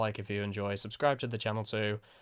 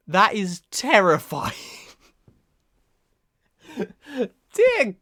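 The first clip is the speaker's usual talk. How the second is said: loud, slow horrified exclaim; hammed-up fright; 9 semitones higher, 9 dB louder, much slower than usual. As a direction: overplay being horrified. Hammed-up fright at scary engine.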